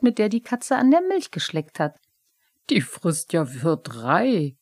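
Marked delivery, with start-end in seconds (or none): 2.7-4.6 mildly put-out grumble; grumbling. A narrator's reading. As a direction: grumble it slowly. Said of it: "brummte er".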